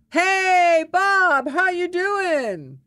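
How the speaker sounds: very high, loud imitated holler; yell across the bar. Man cutting off her answer to greet Bob, yelling. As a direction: bellow a drawn-out hello over someone's answer. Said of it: "he would yell across the bar".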